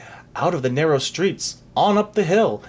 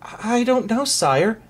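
high, helpless reply; high-voiced, worried boy; bewildered answer; 7 semitones higher, slower than usual. A narrator's reading. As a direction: plead ignorance to a king. Bewildered boy answers raging king.

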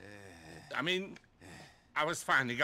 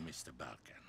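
low breathless threat; menacing whisper; 12 semitones lower, 18 dB quieter than usual. Acted. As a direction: whisper it close to his ear. Thug menaces captive.

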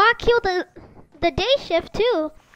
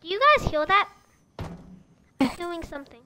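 ordinary delivery

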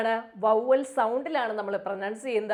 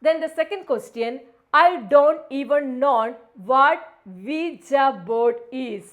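slow, louder question reading; slow read-aloud; 6 dB louder, much slower than usual. Shown as a demonstration. Teacher reading the next exam question to students. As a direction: draw the question out slowly. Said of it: "reads question slowly".

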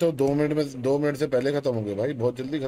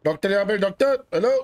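raised, higher-pitched call; 5 semitones higher than usual. Pretend. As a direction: call the doctor over urgently. Calling the doctor over. (ऊँचे सुर में पुकारते हुए)

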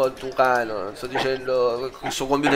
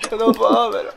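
mock whining; 7 semitones higher than usual. Real, not acted. Lost tournament, whining.

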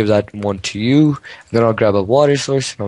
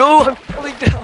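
panicked shout; 14 semitones higher than usual. Real, not acted. Falling in game.

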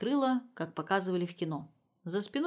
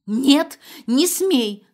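much louder, higher scream; 6 semitones higher, 12 dB louder, much slower than usual. A narrator's reading. Mother screaming to forbid switching on the phone. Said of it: "закричала Инна Владимировна".

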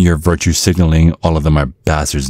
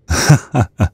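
dad's chuckle; 8 semitones higher than usual. Acted. Menacing dad chuckles at boyfriend.

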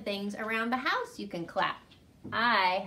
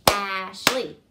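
loud "Logan" in two beats; clapped syllables; 7 dB louder than usual. Shown as a demonstration. Teacher clapping a staff name with pupils. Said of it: "Ready? Go".

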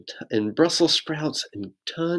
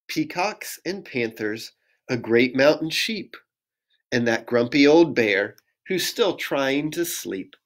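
ordinary delivery, nothing marked

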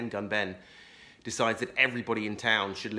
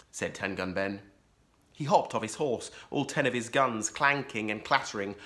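ordinary delivery

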